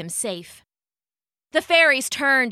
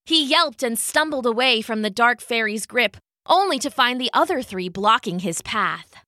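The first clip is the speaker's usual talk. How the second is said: ordinary delivery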